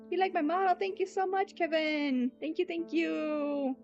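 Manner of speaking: high bubbly thanks; squealy grateful voice; cute anime streamer. Real, not acted. Thanking viewers.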